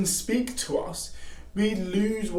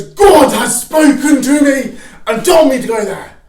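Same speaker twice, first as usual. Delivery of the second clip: loud, slow pulpit proclamation; preacher voice; 5 semitones higher, 13 dB louder, slower than usual. Retold or imitated. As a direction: declare it like a preacher on stage. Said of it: "the preacher goes".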